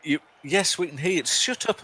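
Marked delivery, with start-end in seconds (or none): 1.2-1.8 mock outrage. Playful squabble over who talks.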